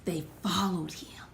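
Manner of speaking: hushed whisper. Acted. Preacher telling the church in a whisper. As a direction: breathe it, unvoiced.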